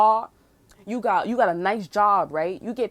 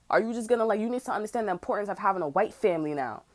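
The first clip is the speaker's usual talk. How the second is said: ordinary talk, nothing marked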